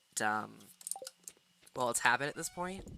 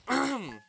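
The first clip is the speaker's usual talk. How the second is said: sharp high throat clear; loud quick throat clearing; 11 semitones higher, 6 dB louder than usual. Real, not acted. Cuts off mid-word.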